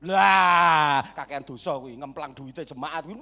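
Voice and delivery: low-pitched mock accusing voice; imitated disapproving congregant, deep; judgmental gossip. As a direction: open on a loud "Lah", then drop low to blame. Voices gossips blaming dead pastor.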